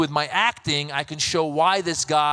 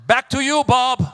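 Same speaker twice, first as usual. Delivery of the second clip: high, louder newsreader sign-off; cheerful anchor; 6 semitones higher, 5 dB louder, slower than usual. Retold or imitated. News anchor handing back to Bob, high bright sign-off. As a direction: toss it back like a chipper anchor.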